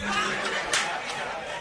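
breathy, quiet laugh; soft voiceless chuckle trailing on; real laughter. Real, not acted. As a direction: laugh softly at your own joke. Laughing at his own joke.

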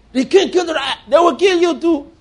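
very high, loud, hammed-up outcry; mock accusation. One who is not Nathan accusing the king, hammed-up high outcry. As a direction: blurt it high and loud in comic alarm.

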